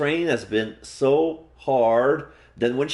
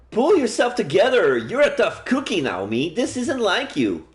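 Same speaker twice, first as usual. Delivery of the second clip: put-on high girlish voice, firm and bracing; Seiko's voice; 10 semitones higher than usual. Seiko urging Naomi to pull herself together, high and firm. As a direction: buck up a crying friend in a high voice. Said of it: "That's Seiko's voice".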